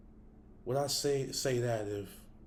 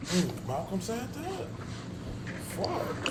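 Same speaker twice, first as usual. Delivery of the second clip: high, stunned disbelief; surprised; 10 semitones higher, much slower than usual. Real, not acted. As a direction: react in real shock. Shocked reaction to the claim.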